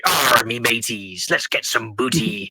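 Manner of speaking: slow, hammed-up West Country pirate growl; West Country pirate accent. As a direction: play a stock West Country pirate, drawn out. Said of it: "That, that's a West Country accent".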